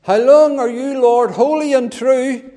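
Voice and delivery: high, loud pleading cry. Retold or imitated. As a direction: cry it out loud like martyrs calling up to God. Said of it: "with a loud voice".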